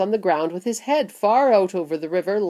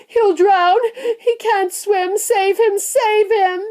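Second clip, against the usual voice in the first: high, loud frantic pleas; panicked screaming; 14 semitones higher, 6 dB louder, slower than usual. A narrator's reading. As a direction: scream in terror for your drowning son. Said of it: "screamed Missus Gloop".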